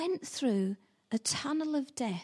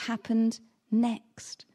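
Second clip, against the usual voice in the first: Happened Next said very slowly, each word weighted; slow and announce the title Happened Next; title Happened Next drawn out slowly; series title emphasis; much slower than usual. Real, not acted. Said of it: "our brand new series called What".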